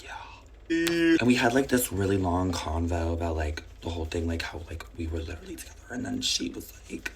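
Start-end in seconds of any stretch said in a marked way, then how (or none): 0.0-1.2 high, breathy whispered yes. Retold or imitated. Whispered reply in retold chat.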